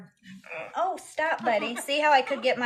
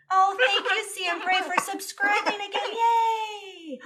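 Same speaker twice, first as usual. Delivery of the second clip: high-pitched happy squeal; excited thanks; 10 semitones higher, slower than usual. Real, not acted. Streamer thanking viewer for subscribing, excited squeal. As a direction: burst into delighted, high cheering.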